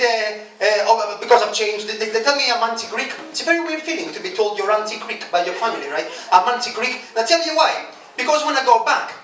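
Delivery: ordinary talk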